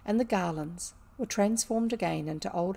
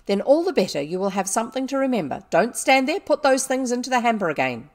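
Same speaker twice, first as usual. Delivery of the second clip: loud, harsh ordering; angry retort; 4 semitones higher, 9 dB louder, faster than usual. A narrator's reading. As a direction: scold and order without mercy.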